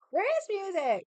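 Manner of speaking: a sudden, high, puzzled cry; surprised outburst. Real, not acted. Sudden outburst about missing music.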